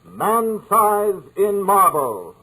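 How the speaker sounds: high, slow, eerie host voice; announcer sign-off. Acted. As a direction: close the tale like a haunted show host. Host signs off the show.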